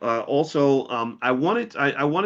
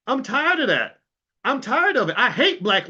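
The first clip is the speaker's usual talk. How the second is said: much higher, fed-up outburst; getting angry; 10 semitones higher, 3 dB louder than usual. Real, not acted. Speaker fed up with shouting at leaders, angry and much higher. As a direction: let the frustration spill over. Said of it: "I'm getting angry".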